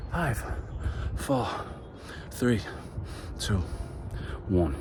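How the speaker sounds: quiet countdown. Shown as a demonstration. Counting down the seconds.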